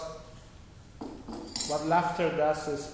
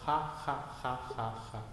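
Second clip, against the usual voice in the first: soft, lower, fading spoken laugh; 5 semitones lower, 7 dB quieter than usual. Shown as a demonstration. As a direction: barely voice the ha ha, fading away. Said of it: "you begin laughing".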